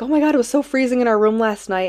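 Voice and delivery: high and loud, animated; excited friend quote. Retold or imitated. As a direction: gush about the cold. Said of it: "he's like".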